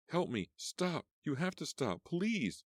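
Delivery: high panicked cries to stop; frantic pleading. Acted. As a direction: throw every word at the car. Stepping into car's path, pleading.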